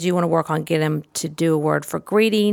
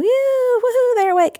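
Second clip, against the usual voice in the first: whooping much higher, celebrating; excited cheer. Shown as a demonstration. Toy person jumps out of bed, whooping much higher. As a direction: cheer like a party's started. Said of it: "big and exciting".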